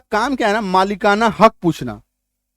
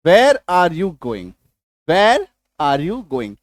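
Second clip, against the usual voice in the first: very slow, word by word; slow demo; 3 semitones lower, much slower than usual. Shown as a demonstration. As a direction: spell it out slowly for students. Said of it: "इसके पहले लिख दीजिए WH word". (बहुत धीरे, एक-एक शब्द)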